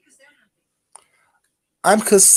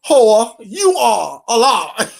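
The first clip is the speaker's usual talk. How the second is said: slow shout; 5 dB louder, much slower than usual. Real, not acted. Shouting at chatter.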